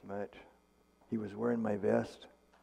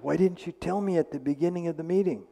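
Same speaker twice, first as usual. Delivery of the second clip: higher, pressing question; a startled why; surprised; 6 semitones higher, 3 dB louder than usual. Retold or imitated. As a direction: ask in disbelief why she waited.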